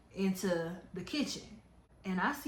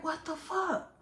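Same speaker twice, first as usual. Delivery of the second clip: acted disbelief; 9 semitones higher than usual. Caller overhearing the fight on FaceTime, re-enacted shocked disbelief. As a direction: re-enact stunned bewilderment. Storyteller acts out shocked reaction.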